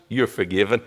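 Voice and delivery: low, soft, tender reassurance; sweet voice. Retold or imitated. Christ forgiving the kneeling preacher, sweet soft voice. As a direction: say it gently and sweetly, right down low. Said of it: "said in this sweet voice".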